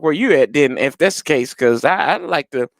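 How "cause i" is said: ordinary talk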